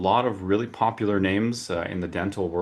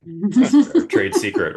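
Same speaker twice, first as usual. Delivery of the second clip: laughing, high and loud, teasing; a laugh bursting through the joke; 11 semitones higher, 9 dB louder, slower than usual. Real, not acted. Host teasing the speaker about his water, laughing.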